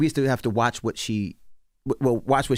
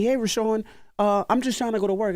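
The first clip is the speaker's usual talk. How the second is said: confused mother-in-law; 10 semitones higher than usual. Retold or imitated. Voices his confused mother-in-law.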